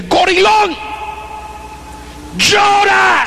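fervent high shouted command to weep; urgent shout. Retold or imitated. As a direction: yell at him to break down and cry. Urging the tough guy to cry.

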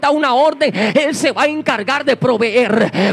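loud, driving sermon voice with hammered refrains; full-force preaching. Acted. Preacher exhorting the congregation about intimacy with God, loud and driving. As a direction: preach it out loud to the whole church.

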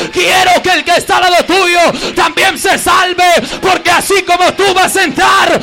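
preacher shouting God's words to the church; preaching at full force. Acted. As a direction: cry out God's longing for his children. Preaching climax.

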